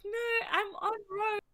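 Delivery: high, drawn-out Yorkshire imitation; mock Yorkshire accent. Imitating the Yorkshire friend's "on road", mock Yorkshire accent. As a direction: tease with a cutesy, slow Yorkshire accent. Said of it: "And then you're like".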